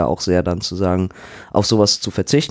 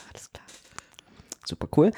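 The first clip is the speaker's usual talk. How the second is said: dry sarcastic whisper; whispered sarcasm; 5 semitones higher, 8 dB quieter, faster than usual. Retold or imitated. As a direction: breathe it out, ironic and resigned. Sarcastic inner thought during exam.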